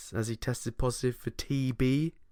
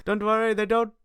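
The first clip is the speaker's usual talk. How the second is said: high mock voice; 9 semitones higher, 3 dB louder than usual. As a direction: keep the high mock voice going. Continues the mock voice.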